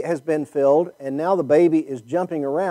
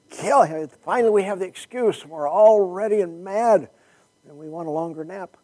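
higher, mock-eager mob voice, laughing into it; mock bloodthirsty congregation; 5 semitones higher than usual. Pastor teasing his listeners as an eager mob, lightly mocking. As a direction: play the grumpy crowd itching to pounce, tongue in cheek.

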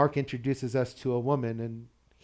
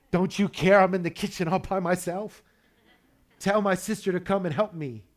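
higher, louder exasperated complaint; mock yell; 6 semitones higher, 4 dB louder than usual. Martha complaining to Jesus about her sister, mild yell. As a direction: complain loudly, lightly acting the overworked sister. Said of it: "comes out and yells at Jesus".

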